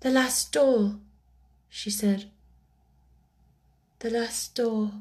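small, halting, mournful words; mournful little voice. A narrator's reading. Orphan looks at her doll, mournfully. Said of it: "little mournful voice had an odd sound".

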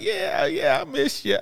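high squeaky imitation; high-pitched mimic of the movie line; mock high voice. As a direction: pipe it up as a caricature. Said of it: "And he's like".

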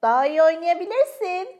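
put-on child teasing her father. A narrator's reading. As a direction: egg dad on cheekily. Francesca teasing dad in game.

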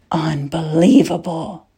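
understated gasp of disbelief. A narrator's reading. King seeing the rider alive, mildly gasping. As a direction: let it out in mild astonishment. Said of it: "Wara gasped".

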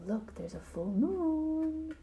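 slow, long high last word; hushed wonder. A narrator's reading. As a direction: stretch moon out in wonder. Wonder at moon, drawn out.

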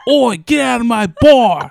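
loud, high mock British biker yell. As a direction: shout like a hammed-up British pub thug. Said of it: "they look like British bikers too".

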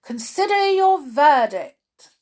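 loud, high royal order; king's command. A narrator's reading. King addressing the jury, loud high command. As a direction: pronounce it loudly to the whole jury. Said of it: "the king said to the jury".

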